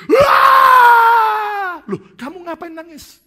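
high, loud startled cry; surprised outburst. Acted. Friend startled that the second pastor cries, high startled shout. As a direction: burst out in shock.

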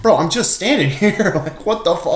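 high, exasperated, half-laughing retort; indignant protest. Retold or imitated. Storyteller retells protesting to frat guys, indignant.

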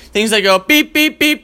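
high mock beeps; beeping alarm imitation. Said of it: "imitating alarm beeps".

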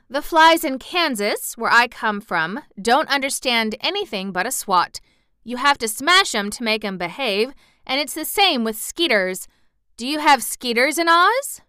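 emphatic, lightly played country aunt; lightly voiced farm aunt. A narrator's reading. Aunt telling the wizard about Kansas flies, lightly emphatic.

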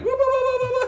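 patting-mouth whoop, hammed up; imitating a cartoon war cry over the oil cap; mock war whoop. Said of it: "It's like an Indian".

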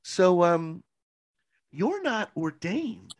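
hammed-up sugary reproach; mock pastor accusation. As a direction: drawl it out like a prim pastor. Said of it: "She was like".

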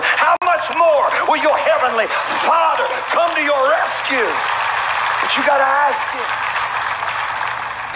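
high, soaring preacher climax; rising revival cry; preaching at full force. Acted. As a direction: lift the promise up and let it ring.

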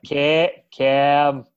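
put-on Cam in a JFK-style Boston accent. Cohost doing a JFK-style Boston accent on Cam. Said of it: "That's more of, like, a J F K".

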